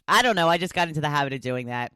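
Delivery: usual speaking voice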